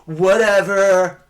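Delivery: loud, high, drawn-out sarcastic whatever. Shown as a demonstration. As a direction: stretch it out to brush off a teaser. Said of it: "When you wanted to be sarcastic".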